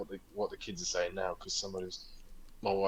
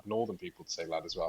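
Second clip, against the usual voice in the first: ordinary talk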